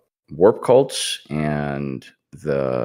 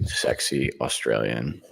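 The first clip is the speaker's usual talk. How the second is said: low, soft attempt at sexy Australian; half-hearted sexy Australian voice; attempted Australian accent; 3 semitones lower, 6 dB quieter than usual. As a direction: try a sultry Australian accent, lightly. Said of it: "I didn't know that was an Australian accent".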